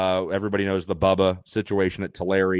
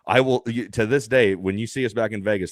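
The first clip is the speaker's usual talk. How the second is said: ordinary delivery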